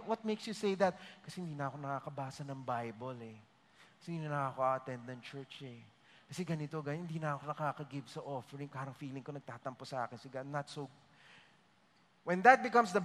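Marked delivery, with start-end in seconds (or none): 1.2-10.9 low, soft, fast mumbled excuses; quiet guilty mumble. Retold or imitated. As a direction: rattle off excuses under your breath. Voicing a guilty believer's excuses.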